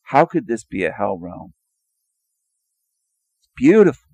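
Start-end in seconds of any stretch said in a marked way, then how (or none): 3.4-4.1 sarcastic gush. Real, not acted. Sarcastic praise of thunderstorm.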